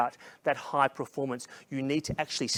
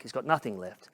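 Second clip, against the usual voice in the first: usual speaking voice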